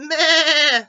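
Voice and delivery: loud, high, wobbling goat bleat. A narrator's reading. Goat greets Blue, loud bleat. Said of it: "said a goat".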